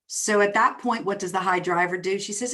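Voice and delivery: normal talking voice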